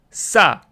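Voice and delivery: 싸 with a tense, forceful s; tense ssa. Shown as a demonstration. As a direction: tighten the throat and snap out 싸. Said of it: "this sound right here is kinda, like, more stressed".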